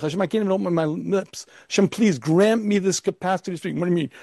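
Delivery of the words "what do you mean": ordinary talk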